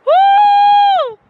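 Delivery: loud high cheer; joyful whoop. Real, not acted. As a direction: whoop with joy. Cheering at the scenery.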